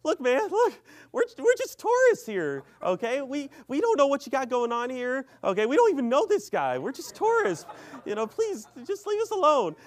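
high, loud, frantic pleading; panicked pleading. Retold or imitated. Tourist face to face with man with crowbar, panicked pleading. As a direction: beg the attacker off in a hammed-up high voice. Acting out friend pleading with attacker.

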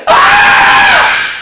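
piercing high shriek of agony; mock scream from hell. Joking impression of a damned soul, shrieking. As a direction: scream like someone burning. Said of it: "another impression of Mother Teresa".

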